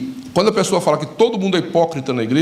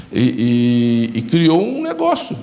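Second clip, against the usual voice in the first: ordinary delivery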